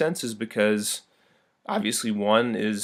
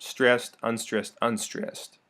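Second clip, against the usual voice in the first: first word punched, the two unstressed ones much softer; stress pattern demo; 3 dB quieter, slower than usual. Shown as a demonstration. Teacher demonstrates dactylic stress, strong then soft. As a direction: drop away after the first beat. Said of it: "the first is accented".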